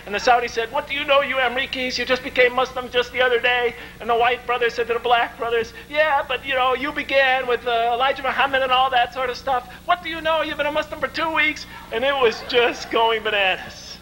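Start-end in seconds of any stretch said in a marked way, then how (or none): 0.7-3.8 higher-pitched, mildly indignant comeback; retold angry retort. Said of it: "People were really starting to get angry". 5.9-11.7 higher-pitched mock indignation; retold argument. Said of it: "People were shouting at each other".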